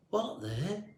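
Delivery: softly startled exclaim. A narrator's reading. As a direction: caught off guard, half breath. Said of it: "he exclaimed".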